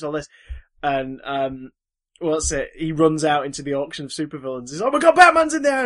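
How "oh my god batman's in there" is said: high, loud panicked yelp; panicked mugger. Retold or imitated. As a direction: blurt it out like a terrified crook. Mugger panics, retold cartoon scene.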